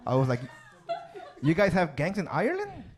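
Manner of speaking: a hint of an Irish accent, lightly done; Irish accent attempt. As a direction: try a loose Irish lilt on the quote. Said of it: "I'm gonna try for you guys".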